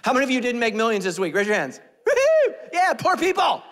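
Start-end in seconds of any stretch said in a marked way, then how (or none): 2.1-3.6 high, loud whoop; mock cheer. Pastor cheering those who made no millions, whooping. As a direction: whoop it up for the crowd.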